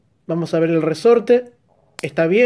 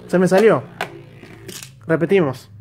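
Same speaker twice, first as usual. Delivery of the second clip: normal talking voice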